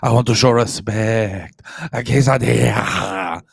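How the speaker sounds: loud, overdone Spanish pronunciation; Spanish accent hammed up loud to show respect; mock Spanish accent. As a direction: lay on a try-hard Spanish accent. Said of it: "we embarrass ourselves 'cause we'll be like".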